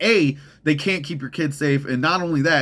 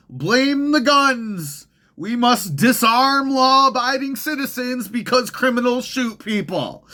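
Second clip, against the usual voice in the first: mock politician; 11 semitones higher, 3 dB louder than usual. Mocking government officials' response.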